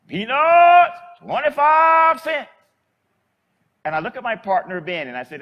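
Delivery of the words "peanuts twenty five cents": high, loud, drawn-out peanut seller's call; street vendor's cry. Retold or imitated. Old lady selling peanuts calls to passing traffic, loud high street cry. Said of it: "She stops".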